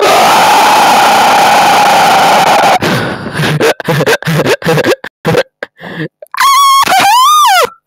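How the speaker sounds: very loud, high-pitched shrieking cackle; piercing drawn-out squeal of laughter; screaming laugh. Real, not acted. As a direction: let out a long, wild screech. Game clowning, wild outburst.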